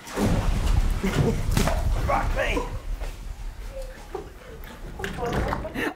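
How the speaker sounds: breathy high-pitched giggle bursting out; can't hold back the giggles; a high laugh breaking through. Real, not acted. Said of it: "I shouldn't laugh".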